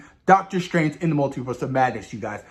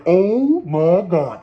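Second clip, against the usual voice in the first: stunned exclaim; 4 dB louder, much slower than usual. Retold or imitated. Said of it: "I was like".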